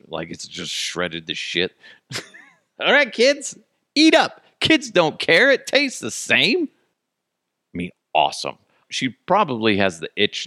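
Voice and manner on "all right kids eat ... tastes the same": put-on high-pitched call to the kids; mock cheery host. Serving shredded cake to kids, high mock-cheery call. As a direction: pitch it way up, calling out.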